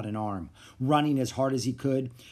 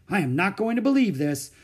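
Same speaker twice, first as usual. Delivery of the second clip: disbelieving; 7 semitones higher, 5 dB louder than usual. Acted. Doctor repeats in disbelief.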